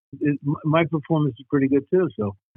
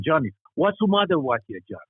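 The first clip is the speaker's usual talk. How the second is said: put-on higher-pitched, heavy Czechoslovak accent; Czechoslovakian grandfather's accent; 7 semitones higher than usual. Grandson imitating his grandfather scolding little Johnny, heavy Czechoslovak accent. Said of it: "this is my grandfather".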